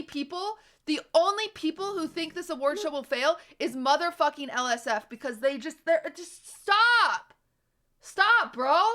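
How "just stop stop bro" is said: loud, high, slow stop; fed-up stop. Real, not acted. Streamer telling chat to stop, loud and fed up. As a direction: shut them down, drawn out.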